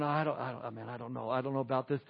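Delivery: a hand-wringing worrier who can't decide; mock fretting. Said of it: "rubbing his hands and going".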